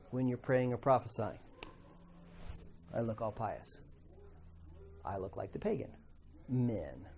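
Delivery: hushed pious voice. Retold or imitated. Pagan men at prayer, soft mock-pious hush. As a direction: mimic a pagan praying all holy and hushed. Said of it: "It says".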